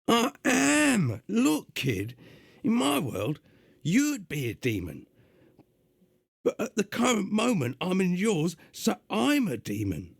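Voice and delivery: gruff, high London demon voice; gruff London accent. Acted. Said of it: "doing a gruff, uh, London accent".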